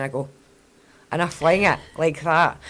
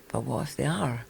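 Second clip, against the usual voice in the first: soft, quieter worried thought; inner doubt; 2 semitones lower, 8 dB quieter than usual. Retold or imitated. Mum's brain doubting herself, soft and worried. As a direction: let the doubt creep in softly.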